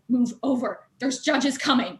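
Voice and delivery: re-enacted tense "out of the way"; hushed but aggressive. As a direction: imitate a pushy hushed warning, drawn out at the end. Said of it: "in a very hushed but very aggressive tone".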